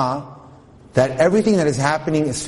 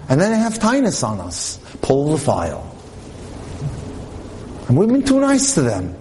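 a hint of a snide prosecutor; accusing angels; 2 semitones higher than usual. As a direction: play the accuser tattling to God.

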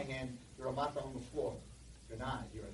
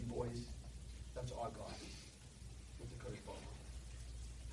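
ordinary talk

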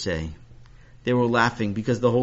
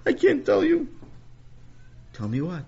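put-on high, upset little boy; a kid confessing, pitched high; young boy voice. Sammy voiced by narrator.

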